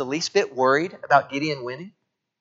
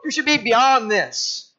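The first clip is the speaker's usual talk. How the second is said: mock impatient dad; 9 semitones higher than usual. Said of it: "And I didn't say".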